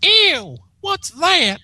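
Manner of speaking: very high squeal of disgust; filly shriek. Acted. Apple Bloom sees the object, high shrieking.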